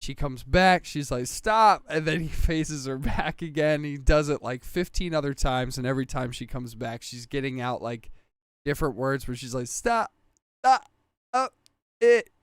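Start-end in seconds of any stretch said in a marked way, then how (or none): 1.3-1.8 mock yell. She yells while phased. 9.7-12.3 mock yelling. She keeps yelling as phased out.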